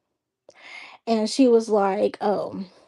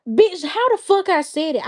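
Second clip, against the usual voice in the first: higher, sharp disbelief; indignant outburst; 7 semitones higher than usual. Real, not acted. Denying what the girl claimed she said, indignant. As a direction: snap back at the accusation.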